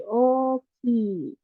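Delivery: slow oki, O and I both lengthened; long vowels in oki. Shown as a demonstration. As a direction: hold the O, then hold the I in oki. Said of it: "dibaca panjang".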